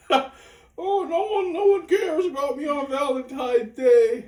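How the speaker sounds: high, loud hammed-up wailing; mock crying. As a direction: fake sob like a lonely girl. Mocks her crying about Valentine's.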